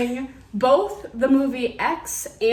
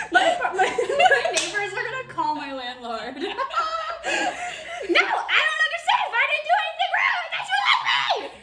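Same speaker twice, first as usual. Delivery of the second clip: laughing. Real, not acted. Laughing fit after shouting no.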